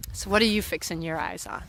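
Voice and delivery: usual speaking voice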